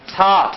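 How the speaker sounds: 사 said as Korean sa, open a vowel; a clear, isolated Korean 사; Korean pronunciation. Shown as a demonstration. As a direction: model 사 firmly as a Korean syllable. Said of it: "요거 한국말로 발음이 뭡니까?".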